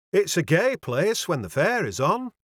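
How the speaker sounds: voiced Yorkshire landlady. A narrator's reading. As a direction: chat in a northern dialect.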